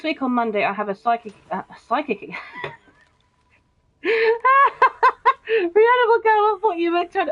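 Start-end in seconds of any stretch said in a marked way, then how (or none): 4.0-7.3 high squealing laugh through the words; cracking up mid-sentence, much higher and louder. Real, not acted. Streamer laughing at own psychic slip, squealing with laughter.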